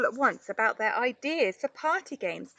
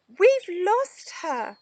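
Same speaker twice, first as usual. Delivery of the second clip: despairing; 10 semitones higher, 5 dB louder, much slower than usual. A narrator's reading. Monty's despairing thought.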